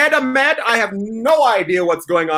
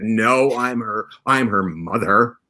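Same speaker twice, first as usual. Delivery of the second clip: mock mother voice; 5 semitones lower than usual. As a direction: play the mother in a put-on low voice. Suggests a deep-voiced cover identity.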